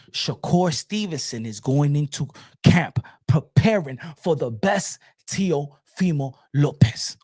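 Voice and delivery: low, measured, phrase by phrase; slow and deliberate. Shown as a demonstration. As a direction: space out every phrase for slow listeners. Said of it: "Let me say it slow".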